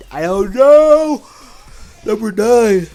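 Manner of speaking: talking through a yawn; sleepy, yawning words. Real, not acted. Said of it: "I'm so sorry about yawning".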